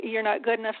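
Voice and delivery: ordinary delivery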